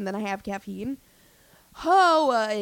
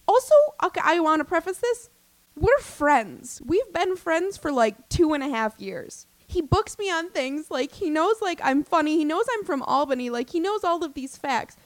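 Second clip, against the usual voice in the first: indignant buildup; 6 semitones higher than usual. Real, not acted. Worked up preface.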